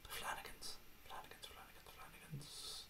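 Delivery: barely audible whisper; whispering to himself. Real, not acted. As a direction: whisper it over and over, thinking aloud. Muttering a brand name while thinking.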